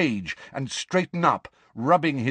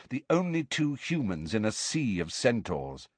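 ordinary delivery, nothing marked

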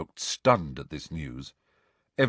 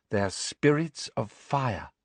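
dreamy Luna; 5 semitones higher, slower than usual. A narrator's reading. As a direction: say it as calm fact. Luna's dreamy voice.

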